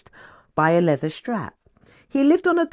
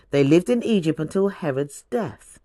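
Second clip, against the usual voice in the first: ordinary delivery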